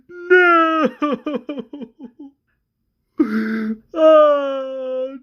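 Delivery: loud, high drawn-out sobbing cries; hammed-up howl of grief; anguished wailing. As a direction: wail and let each cry shake apart. Acted father as daughter is shot.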